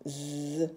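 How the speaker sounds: "Z" as a long buzzing zzz; letter sound of Z. Shown as a demonstration. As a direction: hold the buzz of "Z", not the letter name. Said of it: "le son ze".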